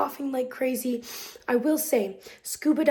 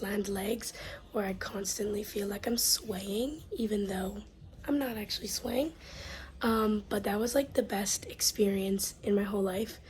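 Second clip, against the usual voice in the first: hushed whisper in a shared room; 2 semitones lower, 8 dB quieter than usual. Real, not acted. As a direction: whisper so roommates sleep on.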